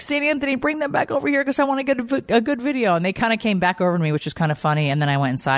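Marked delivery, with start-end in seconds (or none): 0.0-3.0 pleading prayer. Retold or imitated. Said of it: "and I was like".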